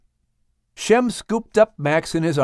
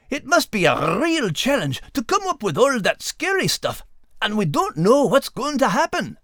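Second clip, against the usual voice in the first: higher voice with a brogue; dog character voice; 8 semitones higher than usual. Acted. Dog recalling his scare, higher voice. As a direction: play the dog, still shaken.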